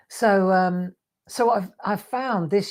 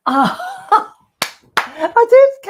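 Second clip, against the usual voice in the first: laughing; 9 dB louder, much slower than usual. Real, not acted. Delighted laugh at Ken's comment.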